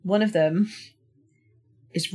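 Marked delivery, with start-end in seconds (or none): none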